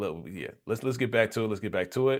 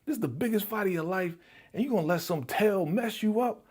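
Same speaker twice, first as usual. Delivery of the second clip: high, fast exasperated telling-off; mock coach slapping sense into him; scolding; 11 semitones higher than usual. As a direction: snap him out of it. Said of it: "trying to slap some sense into his ass".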